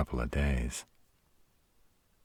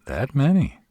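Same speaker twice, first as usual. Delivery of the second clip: high, loud astonished question; taken aback, voice leaping up; surprised; 12 semitones higher, 6 dB louder than usual. Acted. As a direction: jump up in pitch, amazed at the count. Reacts to number of plants.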